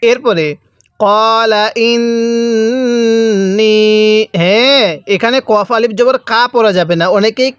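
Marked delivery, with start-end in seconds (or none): 1.0-3.8 drawn-out chanted recitation; slow Quran recitation. Shown as a demonstration. Teacher reciting slowly for students to repeat. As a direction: recite slowly, stretching each vowel. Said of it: "কিভাবে পড়ব?".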